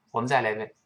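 ordinary talk

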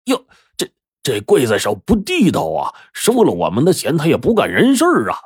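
high indignant outburst; a servant's high-pitched outrage at the cheat; outraged cry. Acted. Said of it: "九天大叫了一声".

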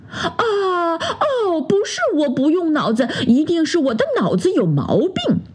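high, whimpering duck voice; mock distressed wail. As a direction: whine that your head is broken. Duck mother realises, distressed. (鸭妈妈拍着脑袋，带着哭腔)

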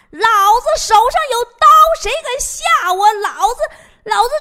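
high, loud, frightened bluster; scared yelling. Retold or imitated. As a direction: yell it to scare off ghosts while terrified. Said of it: "嘴里大喊呐". (高声惊恐地大喊壮胆)

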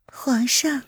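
whispered, gentle call to the emperor; soft tender call. Acted. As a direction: breathe the word lovingly. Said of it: "柔柔地唤道". (柔声轻唤)

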